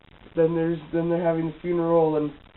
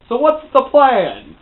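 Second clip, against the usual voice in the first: high, loud, drawn-out question; dramatic shout; 8 semitones higher, 12 dB louder, slower than usual. Retold or imitated. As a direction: burst out with a hammed-up slow yell. Repeats question as sudden dramatic outburst.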